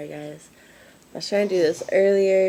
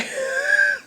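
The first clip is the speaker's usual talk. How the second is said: high burst of laughter. Real, not acted. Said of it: "I'm being mean and laughing at him".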